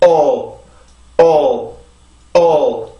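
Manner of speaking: "O" said alone as a long, falling "ol"; syllable drill. Shown as a demonstration. Teacher modelling the first syllable for learners to repeat, isolated syllable drill. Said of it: "자, 다시 발음해볼게요".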